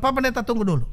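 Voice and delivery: wife stopping pastor. Retold or imitated. Wife stops pastor, voiced high.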